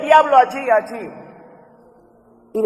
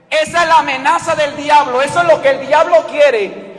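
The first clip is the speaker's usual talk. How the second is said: preaching at full force; 4 semitones higher, 8 dB louder than usual. Acted. Preacher crying out to the church that Satan cannot undo it, roaring. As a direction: build to a roaring climax. Climax of the sermon.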